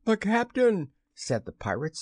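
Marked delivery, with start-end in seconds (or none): 0.0-0.9 high-pitched startled exclamation; surprised pirate. A narrator's reading. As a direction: blurt it high, in astonishment. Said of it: "staring at each other in surprise".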